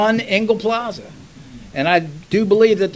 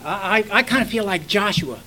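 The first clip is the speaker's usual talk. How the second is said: ordinary delivery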